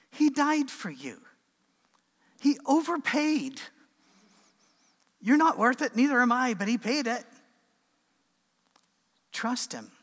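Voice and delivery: high, loud sermon climax; impassioned preaching. Real, not acted. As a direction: preach it with force, driving each phrase home. Preaches the point emphatically.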